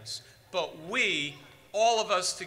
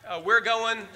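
ordinary talk

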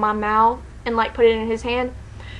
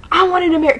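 higher mock yell; retold yelling; 6 semitones higher, faster than usual. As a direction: imitate an indignant protest, pitched up. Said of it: "he starts yelling at Zayn".

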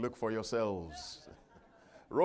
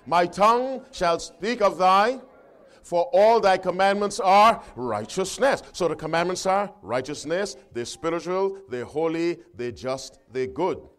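loud, raised scripture reading; ringing pulpit delivery; forceful preaching; 6 semitones higher, 9 dB louder than usual. Acted. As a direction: proclaim each quality of the law with weight. Leads congregation reading the verse.

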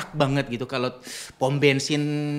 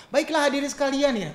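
stage announcer; 11 semitones higher than usual. Shown as a demonstration. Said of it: "Kadang-kadang kalau di panggung".

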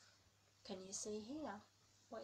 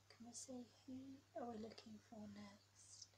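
suspense whisper; 2 semitones higher, 7 dB quieter than usual. A narrator's reading.

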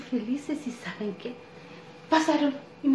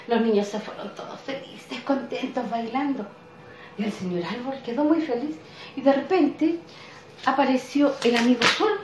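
plain reading voice

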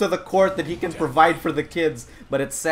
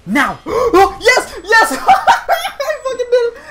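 high, loud laughing celebration; thrilled, squealing triumph; elated; 7 dB louder, slower than usual. Real, not acted. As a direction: whoop and laugh through the win. Lands the move, celebrates.

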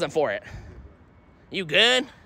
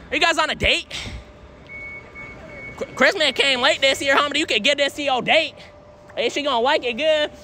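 higher, upbeat salesman voice; eager pitch; 6 semitones higher than usual. Real, not acted. Pitching the tree to a couple on a date, higher and eager. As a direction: charm the couple into taking it, raised.

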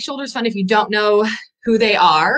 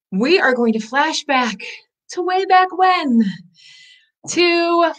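dramatic flashback voice; 7 semitones higher than usual. Pretend.

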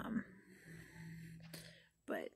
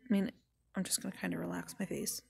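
ordinary talk

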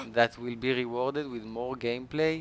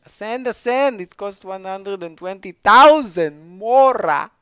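much higher and louder, thrilled; excited shouting; 7 semitones higher, 14 dB louder than usual. Real, not acted. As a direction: shout it out with glee.

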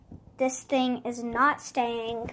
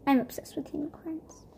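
whispered aside; 5 dB quieter than usual. Real, not acted. Vlogger confiding her unicorn love to viewers, whispered. Whispered confession during unboxing.

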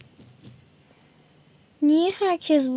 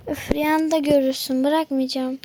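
ordinary delivery

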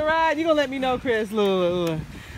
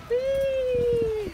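excited whee; 4 dB quieter than usual. Real, not acted. Riding down bumpy sandy slope.

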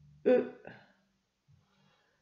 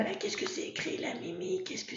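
whisper; 9 semitones higher, 7 dB quieter, faster than usual. Acted. Coaxing the puppet to read.

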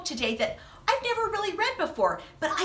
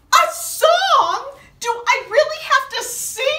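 higher, louder comic dismay; dismayed surprise; 8 semitones higher, 7 dB louder than usual. Acted. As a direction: groan at having to sing. Dismayed at having to sing.

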